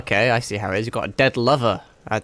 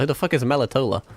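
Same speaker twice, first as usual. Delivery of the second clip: ordinary talk, nothing marked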